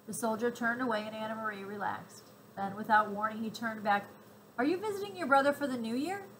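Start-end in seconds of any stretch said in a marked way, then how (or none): 4.6-6.3 sudden suspicious question. A narrator's reading. Soldier testing the mother, sudden probing question. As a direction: pitch jumps up on a sharp, probing question. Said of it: "he asked suddenly".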